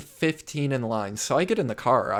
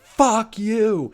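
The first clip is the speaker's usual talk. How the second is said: high, loud, drawn-out curse; retold angry shout; 11 semitones higher, 7 dB louder than usual. Cab driver to drunk friends from his window, imitated angry shout. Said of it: "he's like".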